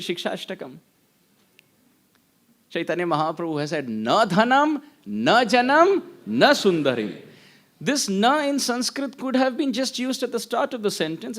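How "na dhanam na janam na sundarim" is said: "Na dhanam, na janam, na sundarim" in three separated phrases; each na separate. Shown as a demonstration. Teacher shows the repeated "na", each phrase separately emphasized. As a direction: give every "na" its own phrase, pausing after each. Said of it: "Mahaprabhu individually uses the nakar".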